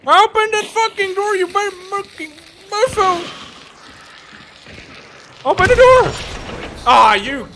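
very high, loud screaming at the door; shrieking rage at a locked door; furious shriek. Real, not acted. Yelling at enemy behind door.

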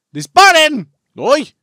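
sudden high, loud yell; panicked shout. Real, not acted. Gamer yelling at enemies in a panic, loud shout. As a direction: scream it as the enemies turn on you.

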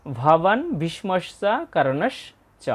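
ভবান ভীষ্মস চ কর্ণস চ spelled out slowly, each sound apart; careful slow Sanskrit recitation demo; slow syllable demo. Shown as a demonstration. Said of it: "প্রথম চরণটার উচ্চারণ পেয়ে গেলাম".

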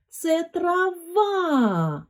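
ТРАВА high and slow, ВА held long then falling; stretched second syllable. Shown as a demonstration. Teacher shows where the stress falls, second syllable of ТРАВА drawn out. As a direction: drag out the final ВА of ТРАВА. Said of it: "Ми протягнули другий склад".

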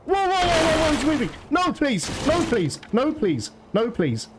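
high-pitched yelp sliding down into pleas; panicked begging, pitch falling with each plea; alarmed pleading. Real, not acted. Player begging the boss not to move, alarmed pleading. Boss suddenly moves in game.

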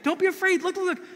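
urgent calming. Retold or imitated. Acting Jesus calming Peter.

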